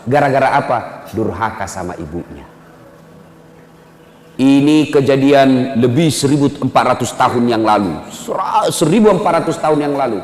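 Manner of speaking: ordinary delivery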